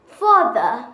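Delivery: Father in a British accent. Shown as a demonstration. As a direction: model Father for repeating. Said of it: "the third word is".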